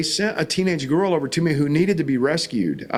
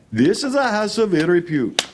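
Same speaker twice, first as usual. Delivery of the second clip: put-on heavy Indian English accent, a bit higher, stiff and formal; 5 semitones higher than usual. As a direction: imitate the Indian driver in a thick Indian accent. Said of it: "says in a very heavy Indian accent".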